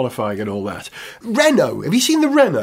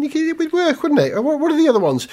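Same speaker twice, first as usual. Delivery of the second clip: ordinary talk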